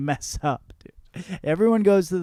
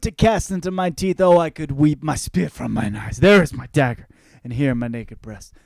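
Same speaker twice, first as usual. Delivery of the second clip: dramatic Shakespeare recitation; 5 dB louder than usual. Acted. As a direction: declaim it like a stage actor, big swings of volume. Performs Cassius speech from Shakespeare.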